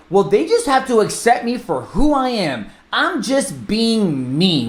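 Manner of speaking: high, whiny-defensive imitation; hammed-up protest, stretching the last words; mock self-justifying. Imitating agents who refuse to adapt, mock defensive. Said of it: "people out there that are like".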